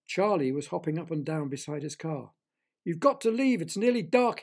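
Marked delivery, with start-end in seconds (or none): 2.8-4.4 high, loud warning; urgent shout. A narrator's reading. Charlie to the children as dark falls, shouting. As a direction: shout to hurry them away. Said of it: "he shouted".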